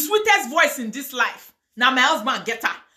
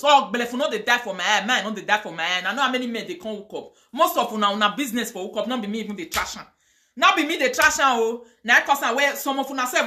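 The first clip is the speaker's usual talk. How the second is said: ordinary talk, nothing marked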